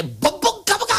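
put-on a grown man's scary bellow at a child; big scary shout. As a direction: roar it to frighten the boy. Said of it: "最後に思い切り目向いて大きな声で".

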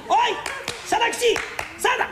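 high, carrying call across the house. Acted. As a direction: summon the servant from afar. Master calls out to servant.

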